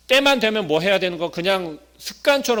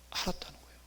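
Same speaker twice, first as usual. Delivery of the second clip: whisper; 15 dB quieter, faster than usual. Real, not acted. Preacher hushing the congregation to a whisper. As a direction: drop to a hushed whisper.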